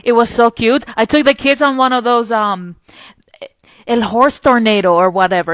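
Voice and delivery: mom groping for the English word; lightly voiced mother searching for words; retold Spanish-speaking mom. Mom tells of taking kids to the mall, lightly imitated. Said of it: "this is what she told me".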